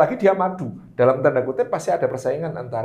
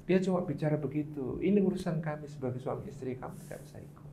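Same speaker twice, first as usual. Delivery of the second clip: calm husband voice, much softer; calm quiet reply; 10 dB quieter than usual. Retold or imitated. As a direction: gently hold off the father-in-law. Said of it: "Rasulullah bilang".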